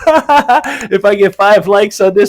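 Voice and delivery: giggling through the words; laughing. Real, not acted. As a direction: laugh while you ask.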